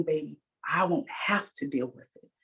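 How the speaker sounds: put-on hushed, voiceless warning to her child; whispered warning. Mother quietly warning her daughter, whispered.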